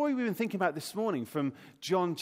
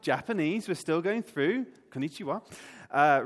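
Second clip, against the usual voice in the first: normal talking voice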